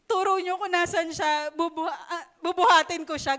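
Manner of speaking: anguished demand, half laughing; desperate plea. Acted. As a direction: plead like she will carry him herself. Acting Mary's desperate plea.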